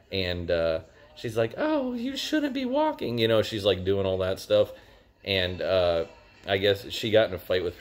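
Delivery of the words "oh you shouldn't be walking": put-on very high, slow childish voice; baby voice. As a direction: talk like a little girl mothering someone. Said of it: "except with a baby voice".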